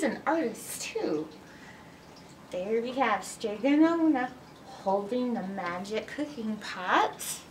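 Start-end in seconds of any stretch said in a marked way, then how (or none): none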